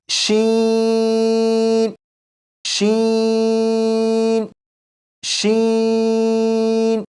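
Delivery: शीन held very long on one level pitch; drawn-out letter. Shown as a demonstration. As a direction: stretch शीन out as a teacher's model. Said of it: "अब शीन को पढ़ेंगे". (शीन को बहुत लंबा खींचकर)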